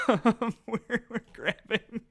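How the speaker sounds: high, breaking up with laughter; real laughing. Real, not acted. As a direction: talk through a real fit of giggles. Cracks up mid-game, talks through laughter.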